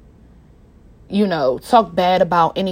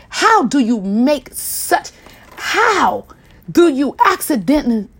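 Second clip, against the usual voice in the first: emotional disbelief; 4 semitones higher than usual. Real, not acted. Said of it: "I'm super emotional with this".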